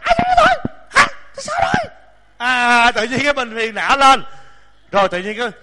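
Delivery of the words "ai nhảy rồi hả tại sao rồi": much higher, snappy mock flare-up; mock irritated outburst. Monk acting his own sudden irritation, high mock snap. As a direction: squeak it out like a temper flaring. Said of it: "Nhiều khi".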